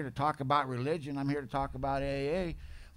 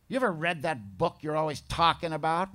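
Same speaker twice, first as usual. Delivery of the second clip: usual speaking voice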